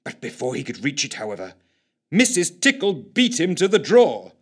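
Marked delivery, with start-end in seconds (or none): none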